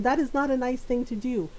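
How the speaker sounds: ordinary delivery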